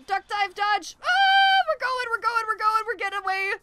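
high, loud urgent shouting; frantic escape shouts. Real, not acted. Gamer shouting frantically while escaping with the bag, high and loud. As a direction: yell them through the getaway. Fleeing the creature with loot.

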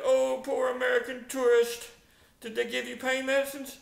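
high, loud show of pity; imitated sympathetic hotel owner; Mister Constantino. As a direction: fuss over the poor tourist. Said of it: "The hotel owner, Mister Constantino, he said".

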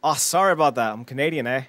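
put-on smug sorry in a Canadian accent; mock Canadian. Mocking Canadians with a put-on Canadian accent. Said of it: "with an air of, of arrogance and cynicism".

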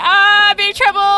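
high, loud panicked cry; panicking gamer. Real, not acted. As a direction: yelp in sudden panic. Panics in game.

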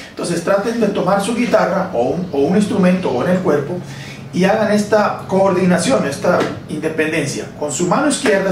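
ordinary delivery

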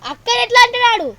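high, loud indignation; real protective outrage; outraged protest. Real, not acted. Child outraged on sister's behalf.

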